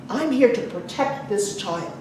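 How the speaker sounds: ordinary delivery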